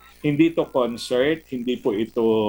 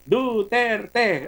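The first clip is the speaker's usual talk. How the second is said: mock chant, higher and drawn out; imitated crowd shout; 7 semitones higher than usual. Student calling out in a huge stadium, mild mock shout. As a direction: call it out like one heckler, without full volume. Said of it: "sumigaw ng".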